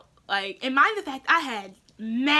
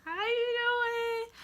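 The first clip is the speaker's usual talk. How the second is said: much higher, softer, slow and sheepish; nervous greeting; 10 semitones higher, 6 dB quieter, much slower than usual. Retold or imitated. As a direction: ease in timidly like you're hiding something. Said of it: "I was sweating bullets".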